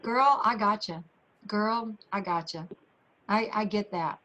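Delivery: soft, low, understanding murmur; quietly sympathetic, girlfriend to girlfriend; reassuring. Retold or imitated. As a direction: let them know you hear them, low and gentle. Voices Vicki listening to customers.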